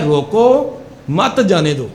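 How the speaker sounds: drawn-out "روکو", long pause, then "مت جانے دو"; pause after روکو. Shown as a demonstration. Teacher shows the officer's intended meaning, slow pause after "روکو". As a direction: slowly split "روکو" off from "مت جانے دو". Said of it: "لیکن وقف کرنے اور نہ کرنے".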